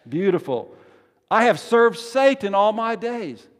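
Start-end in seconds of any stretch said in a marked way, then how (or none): 1.3-3.5 loud, high-pitched line for response; forceful confession, projected high; emphatic call. Acted. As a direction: call it out for the congregation to answer. Leading congregation in call and response.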